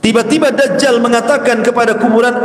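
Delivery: normal talking voice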